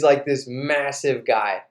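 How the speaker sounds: normal talking voice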